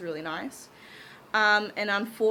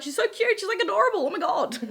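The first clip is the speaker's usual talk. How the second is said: much higher, louder cooing gush; gushing over cuteness; 10 semitones higher, 4 dB louder, faster than usual. Retold or imitated. First seeing the young contestant, high gushing coo. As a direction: squeal it like she's adorable.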